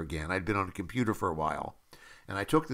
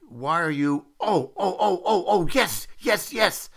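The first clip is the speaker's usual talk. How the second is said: high-pitched excited cries of realization; imitated producer erupting with excitement; 9 semitones higher, 5 dB louder, slower than usual. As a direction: burst into loud, high delight, yes after yes. Producer reacting to actor's accent, acted out.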